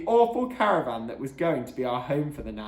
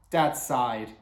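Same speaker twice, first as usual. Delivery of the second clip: ordinary delivery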